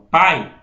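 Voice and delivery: "pai" with a strong, held "a" and a light glide "i"; stressed a in pai. Shown as a demonstration. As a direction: let the "a" of "pai" carry the word. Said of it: "o som que fica é o do A". ("pai" com o "a" forte e o "i" fraco)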